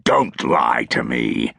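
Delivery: low, slow, hard threat; low slow menacing soldier. A narrator's reading. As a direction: lean in and let it land slowly. Tallis threatens urchin.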